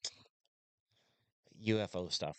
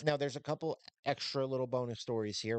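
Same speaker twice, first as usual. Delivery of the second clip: script read; 4 semitones higher than usual. Acted. Reads a prepared script.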